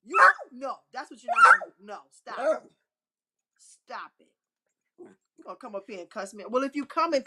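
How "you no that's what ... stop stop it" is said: loud, high warning; telling off the dog. Real, not acted. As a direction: put the dog in its place. Scolding the dog.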